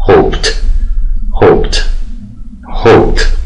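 "هوپت" as one syllable, final t crisp; ending said as t. Shown as a demonstration. Teacher drilling "هوپت" for class, past ending as t. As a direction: clip "هوپت" with a voiceless t at the end. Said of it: "خواستم دی یا ای دی اضافه کنم".

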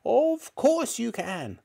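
put-on high old-woman snap, words stretched; snappish grandmother. A narrator's reading. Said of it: "she snapped".

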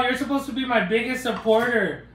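ordinary talk, nothing marked

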